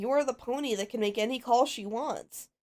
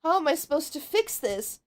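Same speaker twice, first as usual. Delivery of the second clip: ordinary talk, nothing marked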